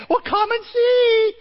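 high, slow teasing sing-song; teasing slow drawl. Retold or imitated. Son teases his dad on the phone, slow and sing-song. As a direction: stretch it out like a tease.

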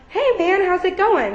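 bright, overly upbeat greeting; mock-cheery high hello. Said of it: "be like".

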